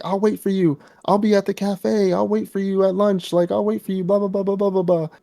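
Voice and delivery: lighthearted high voice promising to wait; sing-song high promise, trailing into blah; high put-on Denji voice. Denji promising Reze to wait, in a high mock voice.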